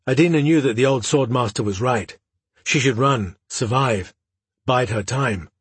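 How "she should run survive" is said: plain reading voice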